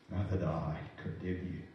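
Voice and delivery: hushed, gentle words of mercy; tender forgiveness. Acted. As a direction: speak it gently, as a quiet pardon to her. Jesus speaking gently to woman.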